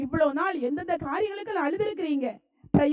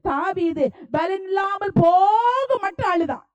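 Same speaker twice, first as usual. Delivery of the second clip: impassioned preaching; 4 dB louder than usual. Real, not acted. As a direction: preach it forcefully, ringing out each phrase. Preaches with full force.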